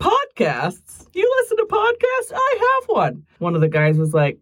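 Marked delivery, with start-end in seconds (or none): 1.2-3.2 surprised. Retold or imitated. Mimics surprised reaction.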